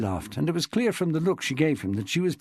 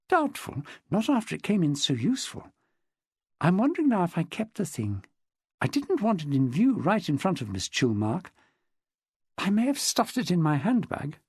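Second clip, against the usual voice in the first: higher-pitched woman's dialogue voice; lightly voiced chatty woman witness; 6 semitones higher than usual. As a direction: lightly play a woman recounting her club life. Narrator voices Jessica in dialogue.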